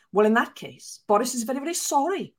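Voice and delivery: sheepish spin doctor in a put-on Welsh accent; hammed-up Welsh accent, conceding; imitating the Welsh spin doctor backing down; mock Welsh accent. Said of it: "This is my Welsh accent".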